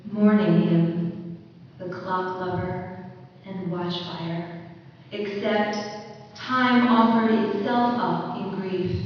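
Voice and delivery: measured, emphatic poem recital; poetry reading. Acted. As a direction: read it line by line, pausing after each phrase. Poet reads her poem.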